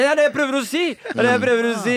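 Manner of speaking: high, loud, frustrated insistence; exasperated outburst. Retold or imitated. To his friends, exasperated high-pitched insisting. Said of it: "Jeg bare".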